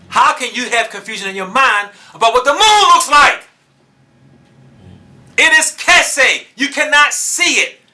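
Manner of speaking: high, loud, fervent sermon delivery; preaching at full force. Acted. Preacher warning the teachers of Yisrael, at full force. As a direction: hammer the point home from the pulpit.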